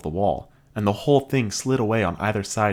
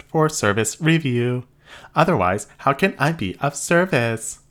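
high, even robot voice; polite machine receptionist; 5 semitones higher, 3 dB louder than usual. Acted. Robot answers.

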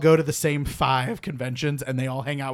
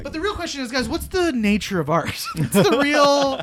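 high, grandiose mock gravitas; mock profound; 10 semitones higher, 4 dB louder than usual. Mock grand philosophical question.

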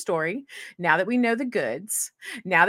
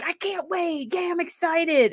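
excited; 9 semitones higher, slower than usual. Retold or imitated. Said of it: "they're like".

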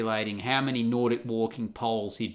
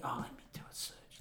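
whisper; 20 dB quieter, faster than usual. Real, not acted. Whispering while searching.

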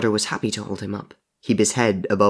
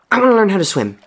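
higher, louder rushed blurt; 11 semitones higher, 6 dB louder, faster than usual. Acted. Henry blurting his wish to the dead boy. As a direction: blurt it out all at once. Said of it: "Henry blurted".

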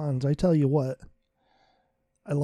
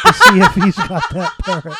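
high squealing laugh through the words; 10 dB louder than usual. Real, not acted. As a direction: crack up mid-line.

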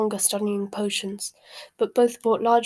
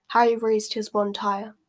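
plain reading voice

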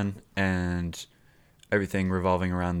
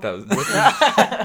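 laughing; 14 semitones higher, 8 dB louder, slower than usual. Real, not acted. Laughs while explaining the bit.

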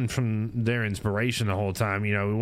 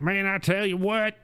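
much higher, twangy hillbilly character line; Billy's hillbilly voice; 9 semitones higher than usual. Retold or imitated. Streamer showing how his character Billy talks, higher hillbilly voice. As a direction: toss it off like a country hillbilly. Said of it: "Billy's more like".

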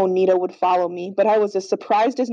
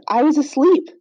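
higher, pleading protest; anxious denial; 6 semitones higher, 3 dB louder than usual. A narrator's reading. Captive lies to the hollering leader, anxious and high. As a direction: insist on innocence, voice pitched up.

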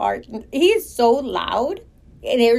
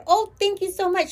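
much higher, sugary thanks; fake gratitude; 8 semitones higher than usual. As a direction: gush the thanks politely. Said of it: "networking professionalism kicked in".

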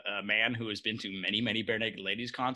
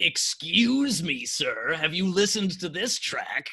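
indignant challenge; 6 semitones higher, 6 dB louder, slower than usual. Pretend. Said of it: "like, the first, like".

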